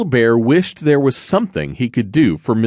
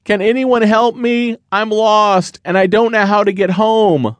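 high, raised calling voice; lost little bear calling out, higher; calling for help; 12 semitones higher, 3 dB louder than usual. Acted. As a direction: call out into the woods.

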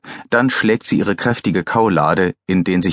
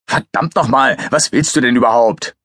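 irritated, raised-pitch outburst; snapping; 5 semitones higher than usual. A narrator's reading. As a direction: snap at him, fed up. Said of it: "blaffte der Leiter des K eins".

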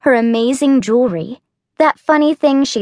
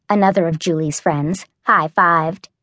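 ordinary delivery, nothing marked

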